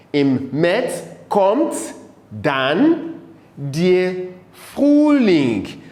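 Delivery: one word at a time, with pauses; halting, deliberate teacher's recitation; slow model reading. Shown as a demonstration. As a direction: dictate the German line in small pieces. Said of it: "you read it after me".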